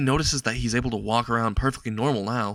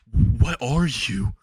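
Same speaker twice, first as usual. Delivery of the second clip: stuttering; 2 semitones higher, 5 dB louder, much slower than usual. A narrator's reading. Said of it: "he says in a stutter".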